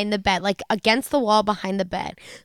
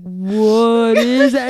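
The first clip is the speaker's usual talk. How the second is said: very slow, stretched-out question; slow motion; 3 dB louder, much slower than usual. Retold or imitated. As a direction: imitate talking in slow-mo. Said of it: "said it in actual slow motion".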